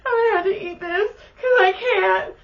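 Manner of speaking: high, wailing fake sob; mock crying. Whining to the table about her food, mock sobbing. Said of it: "but I was all".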